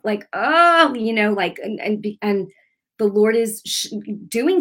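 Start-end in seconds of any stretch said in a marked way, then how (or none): 0.3-0.9 loud, high crying groan; imitated groan. Preacher showing how groaning in prayer sounds, loud groan. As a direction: groan out a sudden "oh", half crying. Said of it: "crying or groaning, like".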